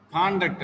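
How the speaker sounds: conduct stressed on con-; first-syllable stress. Shown as a demonstration. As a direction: model conduct as CON-duct. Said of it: "the first letter is the stress point".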